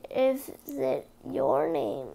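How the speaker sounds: ordinary delivery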